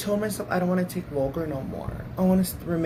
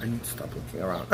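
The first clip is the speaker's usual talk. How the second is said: laughing; 14 semitones higher, 5 dB louder, faster than usual. Real, not acted. Laughing at herself.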